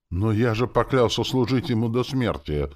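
high, plaintive protest; despairing moan. A narrator's reading. Soldier clinging to his oath, despairing moan. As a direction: wail it lightly in despair. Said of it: "простонал парень в отчаянии".

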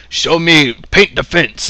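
a light imitation of the old teacher; Mister Miyagi voice. Mister Miyagi instructs Daniel-san, lightly imitated sensei. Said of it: "And Mister Miyagi goes".

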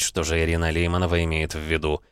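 plain reading voice

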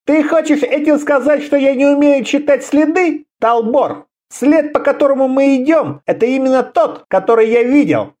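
high-pitched offended retort; indignant challenge. A narrator's reading. Offended quarrel, hand goes to sabre. (возмущённо, высоким голосом)

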